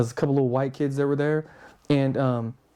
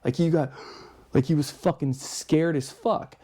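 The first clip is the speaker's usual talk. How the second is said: ordinary talk, nothing marked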